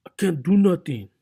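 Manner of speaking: high, loud comedian catchphrase voice; mock comedian's loud, high-pitched protest; George Lopez. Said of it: "in my best George Lopez voice".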